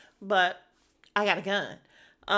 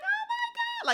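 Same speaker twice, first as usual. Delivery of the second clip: very high squealing oh my God; Doctor Heavenly screaming happily at Quad's arrival; mock scream. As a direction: shriek it with joy. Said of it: "She's like".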